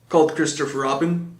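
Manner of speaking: usual speaking voice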